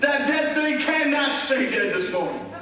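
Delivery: rousing pulpit proclamation; full-force preaching. Real, not acted. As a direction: build to a shouted sermon climax. Preaches at full force, building to shout.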